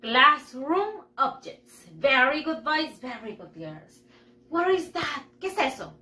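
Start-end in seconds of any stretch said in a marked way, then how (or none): 0.0-1.9 slow, separated words; repeat-after-me model. Shown as a demonstration. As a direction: say "Classroom objects" slowly for learners. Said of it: "Repeat after me". (modelo lento para repetir)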